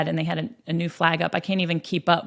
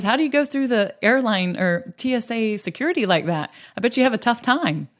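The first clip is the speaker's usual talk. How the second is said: friendly joking; 5 semitones higher, 3 dB louder than usual. Retold or imitated. Brother jokes with stranger.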